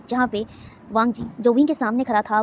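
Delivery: ordinary delivery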